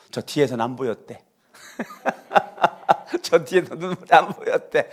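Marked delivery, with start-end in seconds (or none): 2.3-3.2 a quick breathy laugh; short laugh. Real, not acted. 3.2-4.9 said through a laugh. Real, not acted. 웃으며 말함.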